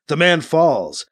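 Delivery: plain reading voice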